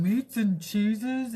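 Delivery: slow, drawn-out mock husband voice; husband's food craving. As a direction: drawl it out like a greedy husband. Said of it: "he's opening the drawer and going".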